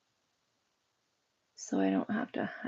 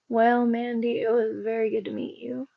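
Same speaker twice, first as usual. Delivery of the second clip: usual speaking voice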